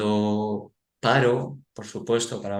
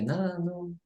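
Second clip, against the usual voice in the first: put-on child's voice; 9 semitones higher, 3 dB quieter than usual. Father quotes son.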